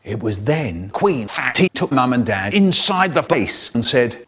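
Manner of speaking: usual speaking voice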